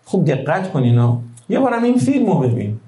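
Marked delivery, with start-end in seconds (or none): 1.5-2.8 یه بارم این فیلمو ببین pitched much higher, stress on این; raised pleading. Acted. Preacher urging the crowd to watch the film, pleading much higher. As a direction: lift your voice high and lean on این.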